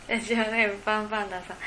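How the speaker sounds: usual speaking voice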